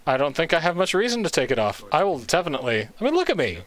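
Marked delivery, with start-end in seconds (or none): 3.0-3.6 delighted. Real, not acted. Admiring himself in wolf mask.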